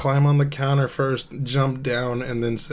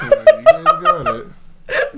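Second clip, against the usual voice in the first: laughing through the words; 7 dB louder, much slower than usual. Real, not acted. Friend laughing as chat guesses right. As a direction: giggle it out, high and slow.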